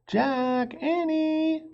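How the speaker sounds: high, sing-song call from afar; calling again. A narrator's reading. Mom calling the children home again, high and carrying. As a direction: call their names across the woods. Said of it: "their mom called again".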